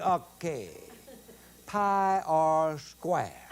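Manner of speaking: son reciting, very slow; slow recitation. Retold or imitated. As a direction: spell it out slowly like a lesson. Son reciting algebra to papa.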